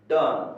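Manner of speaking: "डन" said as one firm, loud syllable; model pronunciation. Shown as a demonstration. Teacher shows students how done sounds, model pronunciation. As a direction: land "डन" loudly as a model word. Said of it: "क्या बोला जाएगा इसको?".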